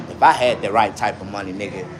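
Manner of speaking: ordinary delivery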